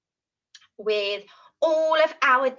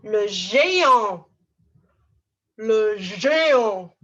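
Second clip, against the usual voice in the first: louder and slow, a booming giant; big giant voice; 8 dB louder, slower than usual. Shown as a demonstration. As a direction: say it like you're huge. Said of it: "show me the action and tell me the word".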